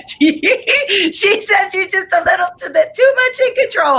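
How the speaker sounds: high, squealing laugh through the words. Real, not acted. Psychic relaying grandmother's teasing message, laughing. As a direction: laugh so hard the pitch shoots up.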